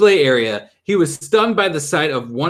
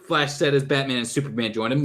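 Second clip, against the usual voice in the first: ordinary delivery, nothing marked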